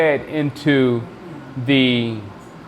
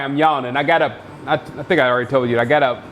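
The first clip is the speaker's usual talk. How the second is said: ordinary talk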